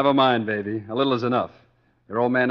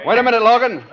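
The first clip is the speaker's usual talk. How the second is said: loud, higher call to stop him; 12 semitones higher, 9 dB louder than usual. Acted. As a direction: raise your voice and call him back. Foreman calling back a worker.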